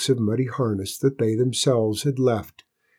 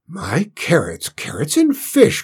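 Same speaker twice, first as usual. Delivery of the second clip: cook's high, swooping exclamation; astonished cry; 6 semitones higher, 4 dB louder than usual. A narrator's reading. Cook amazed at tidy Cinders, crying out. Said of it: "cried the cook".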